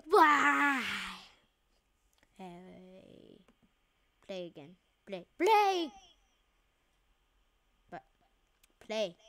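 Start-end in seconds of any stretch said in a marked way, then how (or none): 0.1-0.8 mock wail. Died again. 5.4-5.9 eager shout. Real, not acted. Restarting the game.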